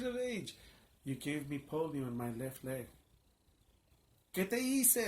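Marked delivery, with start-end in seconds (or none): 4.3-5.1 high, loud accusing cry; retold shout, "what did I do"; yelling at God. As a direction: fling it upward in anguish. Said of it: "I began to yell out".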